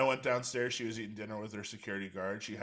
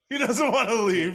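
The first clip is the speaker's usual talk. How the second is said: loud, high-pitched mimicry with a laugh; mock excuse; 12 semitones higher, 14 dB louder than usual. Co-host voicing friends who didn't call, loud mocking. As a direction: blurt out their lame excuse, much higher and louder.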